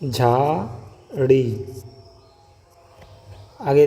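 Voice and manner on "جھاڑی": جھاڑی drawn out very slowly, aspirated jh clear; slow sounded-out word. Shown as a demonstration. Teacher slowly sounding جھاڑی for students. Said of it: "جھے ہے".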